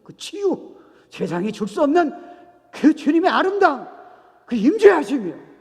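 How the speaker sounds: fervent preaching climax; rising to a shout. Real, not acted. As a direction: build to a full-throated cry of praise.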